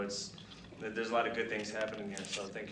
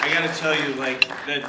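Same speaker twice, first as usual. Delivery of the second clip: grab the room's attention; emphatic opener; 3 semitones higher, 10 dB louder than usual. Real, not acted. Retiree warning airmen about the last year, loud and emphatic. Urging the audience, worked up.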